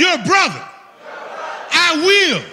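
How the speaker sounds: shouted call to the crowd; loud. Acted. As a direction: belt it out for them to repeat. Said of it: "make it loud".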